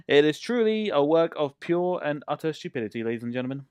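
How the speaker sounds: loud, high, grandly pitched proclamation; mock showman. Streamer presenting stupid build to audience, loud mock-grand announcement. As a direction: present it like a master of ceremonies.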